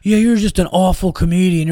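half-yelled heckle, lightly acted; imitated heckler yelling. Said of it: "he just starts, like, yelling".

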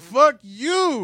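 loud, high retold cursing; mock furious echo. Shopper joining in against the manager, loud mock fury. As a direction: echo the curse loudly, voice up.

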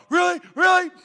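high, loud excited yips; eager little dog. Retold or imitated. Husband hearing about dessert, high puppyish excitement. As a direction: yelp it like a hopeful puppy. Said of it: "I felt like a little dog".

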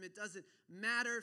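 MATTER punched out loud; emphatic declaration. Acted. Preacher voices faithfulness dismissing the enemy, MATTER said loud and emphatic. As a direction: rise out of the hush and hit "matter" hard. Prayer rises to firm declaration.

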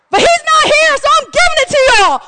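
high, loud playful proclamation; gleeful shout. Real, not acted. Preacher to congregation while husband is away, gleeful shout. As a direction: shout it out in mock defiance. Gleeful defiant announcement of extra point.

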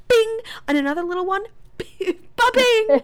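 high, loud, hammed-up taunt; gleeful smashing. Maria breaking saucers one by one, gleeful smashing. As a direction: sing out each crash with mock glee.

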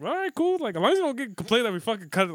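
high, breezy put-on reply; mock casual agreement. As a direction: shrug it off lightly. Retelling his reply to the Hawaiians.

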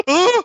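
high, loud imitated grunt; mock commentator grunt. Guest commentator reacting at the desk, high mock grunting. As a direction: yelp out a lecherous "huh". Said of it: "the only thing you'd hear over the commentator desk".

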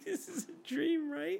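high, slow mock-naive voice; mocking friend. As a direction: parrot the friend's question in a silly high voice. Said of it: "You're like".

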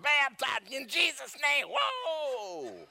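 high, loud, drawn-out holler ending in a long whoa; frantic baptismal shout. Acted. Preacher baptizing a yowling cat, frantic comic shouting. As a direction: shout it like wrestling a wild animal. Acts out baptizing struggling cats.

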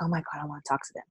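soft, rushed eager murmur; eager aside. Retold or imitated. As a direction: rush it under your breath. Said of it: "I'm like".

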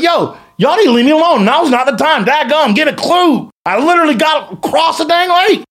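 high, hammed-up yell at the crowd; mock yell. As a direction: play it like a fed-up guy yelling them off. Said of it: "I'm gonna yell at the crowd".

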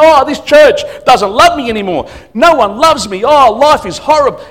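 high, loud mock sulky wail; whinging tantrum. Church member throwing a tantrum in the office, mock whinging. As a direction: complain like an overgrown six-year-old, hammed up. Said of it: "sooking and screaming and whinging and complaining".

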